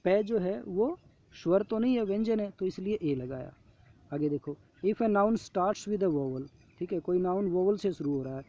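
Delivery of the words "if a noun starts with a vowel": slow reading. Shown as a demonstration. Reading the worksheet rule.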